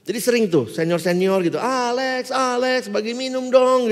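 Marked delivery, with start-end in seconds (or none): 1.6-3.9 drawn-out, higher-pitched call; sing-out imitation of a friend calling; seniors calling out. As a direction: call out for Alex as a visitor. Seniors calling out at his door.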